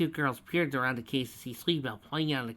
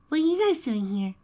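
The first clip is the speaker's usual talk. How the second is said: high, loud put-on innocence; falsely bright question; fake cheer; 13 semitones higher, 8 dB louder than usual. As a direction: act casual but overdo it.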